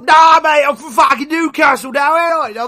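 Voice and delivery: put-on loud, high-pitched bogus Newcastle accent; mock Newcastle accent. Mocking a wrestler claiming Newcastle, loud fake accent. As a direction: blare out a badly faked Newcastle accent. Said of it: "Do you wanna listen to something from Newcastle?".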